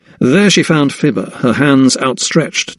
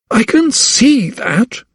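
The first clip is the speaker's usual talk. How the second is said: slow, much higher, icy dismissal; cold reply; 12 semitones higher, slower than usual. A narrator's reading. Mistress dismissing the dead boy, cold. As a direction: freeze her out. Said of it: "she replied coldly".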